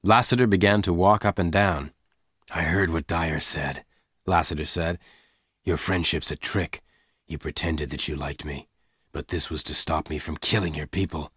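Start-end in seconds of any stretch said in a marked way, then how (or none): 2.5-11.3 lower, softer steady voice; calm man reassuring her; calm gunman. A narrator's reading. Lassiter calmly explaining to Jane.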